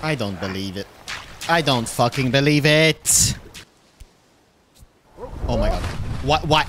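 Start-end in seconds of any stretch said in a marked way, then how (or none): none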